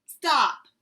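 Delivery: a sudden loud, high bark; growled. Acted. Sick Bear interrupting Mouse's cheerful story, loud growl. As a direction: cut him off with a loud growl. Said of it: "growled Bear".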